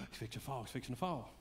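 soft, hushed worried murmur; anxious crowd hush. Retold or imitated. Preacher voicing the crowd fearing the walker will fall, hushed. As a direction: hush it like a nervous onlooker. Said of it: "everybody's like".

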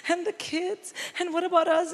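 high, fast, mock-tearful whine to mum; whimpering panic to her mother, hammed-up; a hammed-up teary plea; mock crying. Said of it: "I was crying".

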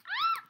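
very high-pitched shriek; startled scream. Real, not acted. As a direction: scream in fright at a jump scare. Startled during scary game.

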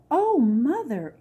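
awed princess hearing the crown is hers; gasping. Acted. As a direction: gasp it out in amazement. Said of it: "Aurora gasped".

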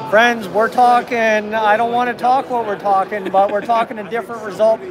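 ordinary delivery

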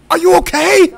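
mock shout. Mimicking NPC, shouting.

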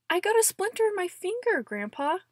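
put-on high, loud, fast kid's whine; whiny child. Child telling Grandpa about a splinter, high fast whine. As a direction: whine it like a hurt little kid.

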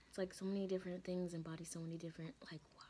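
quick fading whisper. Real, not acted. Overwhelmed, trailing.

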